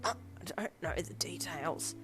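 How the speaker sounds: slow, strained, choking reply; choked. A narrator's reading. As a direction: force the words out past a squeezed throat. Pinned man choking out answers.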